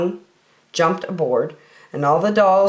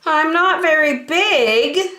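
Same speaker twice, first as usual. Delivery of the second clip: high, slow and humble; little blue engine telling the toys she is small; modest little engine; 10 semitones higher, slower than usual. Acted. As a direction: admit you are small. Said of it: "said the little blue engine".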